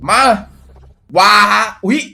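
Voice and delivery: loud, high startled cry; real shock over the card; surprised yelp. Real, not acted. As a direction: jolt at the reveal. New card reveal.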